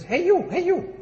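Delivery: high, swooping bird call; talking parrot. Acted. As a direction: squawk it out like a parrot calling a passerby. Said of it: "And the bird says".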